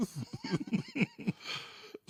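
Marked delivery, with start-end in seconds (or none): 0.0-0.1 acted quote. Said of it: "Be like".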